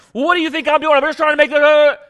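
imagined wife snapping back at her husband; angry comeback. Pretend. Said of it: "come back at me with something like".

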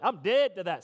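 loud, punched declaration; emphatic, dismissive refusal; with attitude. Shown as a demonstration. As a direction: demonstrate the attitude, hitting DEAD hard. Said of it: "there's an attitude with this".

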